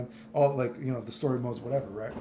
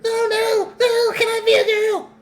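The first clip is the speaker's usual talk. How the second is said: very high and louder squealing plea; mocking Bridget; 9 dB louder than usual. Streamer mocking Bridget begging society, shrill squeal. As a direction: squeal it like a desperate child. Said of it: "They're like".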